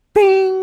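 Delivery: high sung bing; ping like an idea lighting up; bing sound. A narrator's reading. Sound effect in story.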